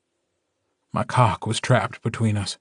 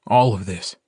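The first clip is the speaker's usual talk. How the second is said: ordinary delivery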